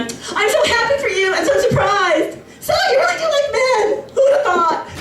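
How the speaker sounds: high, syrupy mock congratulations; gushing guests. Wedding guests congratulating the bride, sugary high mock voice. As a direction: gush with fake surprise, very high. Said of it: "everyone was going on".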